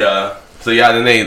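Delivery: ordinary talk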